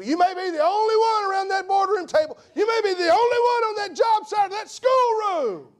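high, loud preacher's shout; preaching at full force. Acted. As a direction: thunder it from the pulpit. Climactic preaching build.

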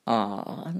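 low cartoon cat voice; deep, unbothered storybook cat; dismissive cat. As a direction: brush it off in a deep voice. Cat shrugs it off.

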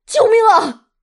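high, loud terrified scream; howling for help. Acted. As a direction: scream for your life, pitch shot right up. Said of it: "他嚎叫着".

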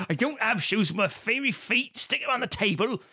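high-pitched mock West Country hobbit; hammed-up West Country country bumpkin; West Country accent. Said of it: "hobbits have West Country accents".

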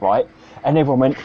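usual speaking voice

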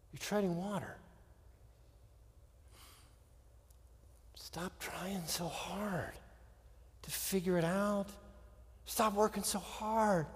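soft, spacious delivery with long pauses; hushed prayerful preaching. Acted. Preacher urging stillness and praying to God, hushed.

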